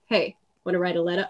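mock a crack dealer's pitch; shady dealer. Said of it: "He's like".

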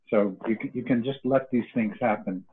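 ordinary talk

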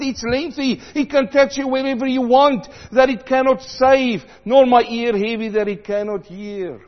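loud, soaring preacher voice; preaching at full force. Acted. Preacher urging the congregation to return, at full force. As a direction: call it out over the church.

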